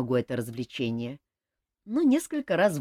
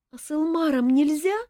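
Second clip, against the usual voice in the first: plaintive; 11 semitones higher, 5 dB louder than usual. A narrator's reading. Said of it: "жалобно спросила Ольга".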